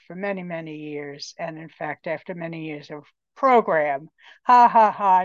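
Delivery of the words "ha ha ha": loud, mirthless ha-ha-ha; spoken fake laugh, not amused; mock laughter. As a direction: say the laugh as pointed words. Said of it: "no, it isn't funny".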